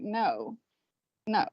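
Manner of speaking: mild, quiet retold disapproval; retold disappointment. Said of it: "just disappointed".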